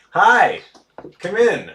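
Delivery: loud call through the door. Real, not acted. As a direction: shout a welcome to the door. Calling to someone at door.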